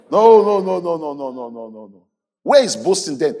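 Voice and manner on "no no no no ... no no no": fading refusal. Real, not acted. Rejects the boast, dying away.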